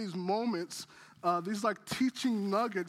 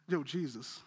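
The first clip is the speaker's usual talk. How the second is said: a low, quiet, offhand "Yo, Jesus" like greeting a buddy; casual mock greeting; 8 semitones lower, 5 dB quieter than usual. Preacher jokingly imagining Peter being casual with Jesus, low and quiet. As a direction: toss off "Yo, Jesus" casually, dropping the voice. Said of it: "No, he didn't say".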